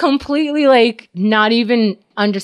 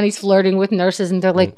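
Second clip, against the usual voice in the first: ordinary delivery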